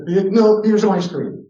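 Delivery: high, loud hurried bribe to stop tears; hammed-up parent fussing over a crying child; frantic mock placating. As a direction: cut off the crying, loudly and high. Mock parent stopping tears with treats.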